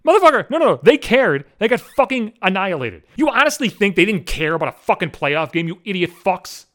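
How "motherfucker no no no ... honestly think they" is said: high, loud burst of indignation; raised-voice angry rebuttal; exasperated retort. Acted. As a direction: cut the critics off with a loud, heated objection. Angry retort to online critics.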